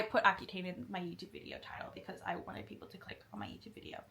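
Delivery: fast secretive whisper of a confession; confessional whisper. Real, not acted. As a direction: whisper it like admitting a guilty secret. Confessing clickbait title as aside.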